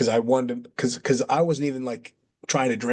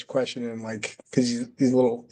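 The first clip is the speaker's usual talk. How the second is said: ordinary talk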